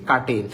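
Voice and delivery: কাটেল repeated as ka-tel, R left out; silent-R pronunciation. Shown as a demonstration. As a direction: model কাটেল without any R. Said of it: "উচ্চারণটা কাটেল". (কাটেল, র বাদ দিয়ে)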